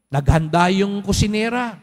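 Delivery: normal talking voice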